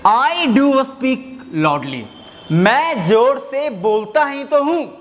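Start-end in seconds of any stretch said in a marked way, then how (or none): 0.1-2.0 slow, do leaned on; stress on do. Shown as a demonstration. Teacher reads the emphatic sentence to students, stressing do. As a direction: read out slowly, pressing on do. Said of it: "first sentence क्या कहता है".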